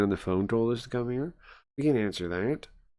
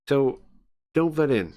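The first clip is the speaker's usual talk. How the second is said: stern warning; 5 semitones higher, 4 dB louder than usual. Acted. Inspector warns them off.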